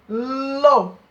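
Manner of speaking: lo said loud with the L tongued against the teeth; sounding out lo. Shown as a demonstration. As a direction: hit lo hard for the class. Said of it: "Vocês viram o som?".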